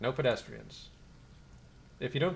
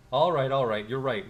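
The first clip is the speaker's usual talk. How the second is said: loud placating; 7 dB louder than usual. Acted. Gang leader backing down.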